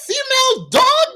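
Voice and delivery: high-pitched mock angry what did you say; angry retort. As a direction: confront the customer in outrage. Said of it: "the Amazon worker said".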